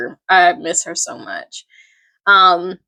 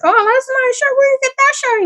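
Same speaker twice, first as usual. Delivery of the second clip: high, impressed asking voice; admiring friend; faster than usual. Retold or imitated. Friends admiring the shirt, high and impressed. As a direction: gush over the shirt up high. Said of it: "their friends is like".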